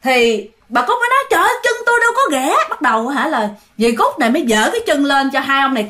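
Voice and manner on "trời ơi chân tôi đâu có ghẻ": high, fast protest; imitating a flustered woman objecting; acted indignant denial. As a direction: blurt out a high-pitched denial. Acted protest to the two men.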